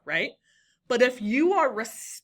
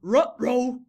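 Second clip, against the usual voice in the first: put-on cartoon dog voice, louder; Scooby-Doo; 5 dB louder than usual. Teacher showing a student a character's catchphrase, cartoon dog imitation. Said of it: "have Scooby-Doo say".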